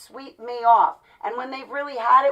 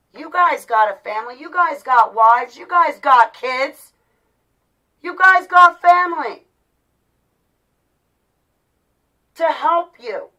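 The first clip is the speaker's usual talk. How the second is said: real anguished shout; 5 semitones higher, 9 dB louder than usual. Real, not acted. Vlogger telling viewers they have family to help, anguished shouting.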